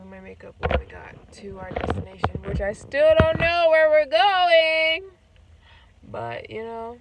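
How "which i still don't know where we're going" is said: high, drawn-out holler; loud exclamation. Real, not acted. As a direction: belt it out, stretching the words. Playful complaint about unknown destination.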